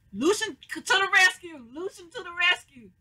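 high, loud cheer for the hero; excited cheering. Real, not acted. Viewer cheering Lucian bursting in, loud excited cheer. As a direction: shout it like the cavalry just arrived.

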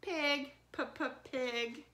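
Pig, then P-p-pig with the P repeated; letter sound demo. Shown as a demonstration. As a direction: split off the P in Pig. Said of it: "P says P".

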